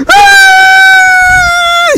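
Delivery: sudden high piercing yell, imitated; retold scream. Sparring kid suddenly screams after a side kick, high mock yell. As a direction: let out a shrill mock scream of pain. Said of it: "he's like".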